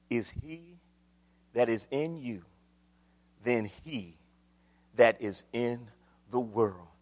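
low, soft, measured phrases; solemn, low-voiced verse; hushed scripture. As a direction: recite it quietly with pauses. Solemn scripture after loud build.

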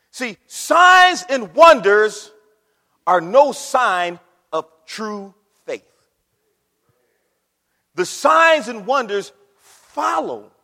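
loud, slow, weighty pulpit declaration; forceful, measured sermon proclamation; emphatic preaching. Real, not acted. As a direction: land each word slowly and loudly. Preaches the main point forcefully.

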